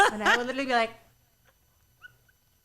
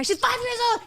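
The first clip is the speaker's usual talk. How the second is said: high-pitched, raised mock protest; worked-up retort; 10 semitones higher, 4 dB louder than usual. Mother arguing back about her young daughter, high outburst. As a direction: imitate yourself arguing back, pitch shooting up. Acted argument with boyfriend.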